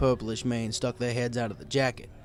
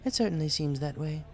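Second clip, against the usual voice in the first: higher, playful lady pony; Rarity voice; 4 semitones higher than usual. A narrator's reading. Rarity plays along with the disguised kid, teasing. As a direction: play along sweetly.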